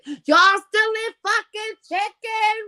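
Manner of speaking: high drawn-out mock holler; loudmouth cousin. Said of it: "my loudmouth ass cousin".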